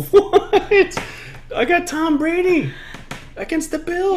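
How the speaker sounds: high, loud shocked protest; can't believe the betrayal; outraged disbelief. Real, not acted.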